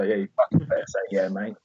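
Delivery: fast, soft threatening whisper; menacing whisper. Retold or imitated. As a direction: hiss it at them under your breath. Threatening kids while retelling.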